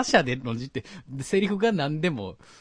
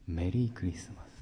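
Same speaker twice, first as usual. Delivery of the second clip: low, dandy grown-up voice; cool handsome guy; 6 semitones lower, 3 dB quieter than usual. Acted. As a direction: say it as a suave heartthrob, low and easy. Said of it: "イケメン風に". (イケメン風の大人な声)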